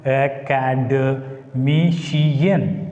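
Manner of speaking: Academician very slowly, stress on MI; slow model word. Shown as a demonstration. Teacher slowly models Academician, stress on MI. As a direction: draw Academician out syllable by syllable. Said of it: "take stress on anti-penultimate".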